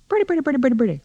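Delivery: rapid high-pitched babble, falling; high chatter. Acted. Mama demonstrating talk to upstairs, high chatter. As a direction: rattle it off high, like mimicked prattle.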